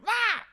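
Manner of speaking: very high, loud cry; Brandy crying out when Phaedra comforts her; mock wail. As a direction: let out a drunken wail. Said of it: "And Brandy's like".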